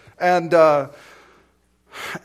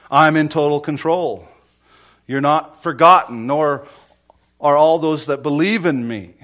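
proclaiming Jesus' words; 11 dB louder than usual. Acted. Preacher voices Jesus' reassurance.

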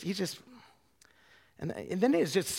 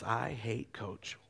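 low, slow, soft chant to himself; slow muttered routine before a shot; muttered mantra; 4 semitones lower, 7 dB quieter, much slower than usual. Retold or imitated. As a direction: drag it out quietly like a ritual. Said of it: "he'd get a little mantra".

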